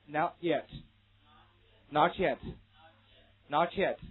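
"Not yet" slowly blended into not-chet; linked ch sound. Shown as a demonstration. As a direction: drill "Not yet" as not-chet, pausing between. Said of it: "这是第一种音变".

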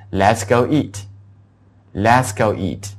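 Let's go eat, said very slowly; slow model sentence. Shown as a demonstration. Teacher modelling an invitation: slow model sentence. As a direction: spell out each word slowly. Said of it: "就是".

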